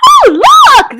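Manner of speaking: very high, loud delighted cry; children thrilled at a new toy; excited children crying. A narrator's reading. Children spotting the seesaw: high excited cry. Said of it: "they cried".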